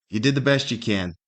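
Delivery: ordinary talk, nothing marked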